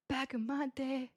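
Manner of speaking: slow, soft put-on old-timer; a hint of a reminiscing elder, hushed and slow; mock old-timer.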